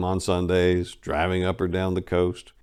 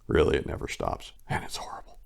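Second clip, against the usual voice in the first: conspiratorial whisper, sharing a secret gripe; whispered aside; 5 semitones lower, 5 dB quieter than usual. Acted. Narrator complaining about his nonstop-talking mother, whispering. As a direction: breathe it out like a confession nobody else should hear.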